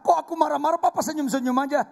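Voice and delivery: high, loud, quick complaining wife. Retold or imitated. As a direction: play the wife whining at her husband. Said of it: "pas istri kita bilang".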